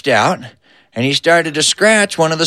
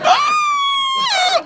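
long, high-pitched sucked-in gasp; huge gasping inhale. Retold or imitated. Uncle Debo hears about polecats, long horrified inhale. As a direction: inhale as if never exhaling again. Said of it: "inhaled like he never intended to exhale again".

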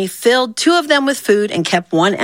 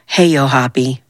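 sighing, reluctant greeting; 6 semitones lower than usual. A narrator's reading. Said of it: "Zia sighed and went over".

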